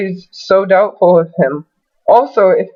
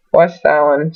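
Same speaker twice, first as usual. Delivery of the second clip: ordinary talk, nothing marked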